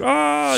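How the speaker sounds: put-on a sudden high, soft frustrated "ah" like a kid losing it; imitated frustrated outburst. Mimicking his ten-year-old daughter's temper over socks, high frustrated cry. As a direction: yelp out the daughter's tantrum sound. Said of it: "you know, losing her temper".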